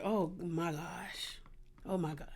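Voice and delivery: whispered, drawn-out mortified reaction; low stunned whisper; hushed disbelief. Retold or imitated. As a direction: say it under your breath, aghast. Retold stunned reaction to awkward scene.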